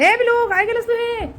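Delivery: high, slow, drawn-out reproach; high-pitched doting telling-off; scolding a pet. Real, not acted. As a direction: call out the pet's mischief in a high voice.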